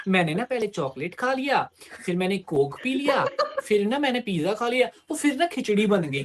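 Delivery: mock a grown woman's baby talk, giggling; a hint of a girlfriend cooing her food list; childish girl talk. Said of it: "जिस tone में मैं बोलूंगा उसी tone में बोल रही थी".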